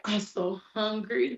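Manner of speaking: daughter's line in a light mock British accent. Mother imitating her daughter's new British-accented complaint. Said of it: "she British now".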